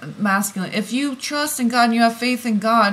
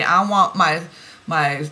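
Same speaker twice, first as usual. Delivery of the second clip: ordinary delivery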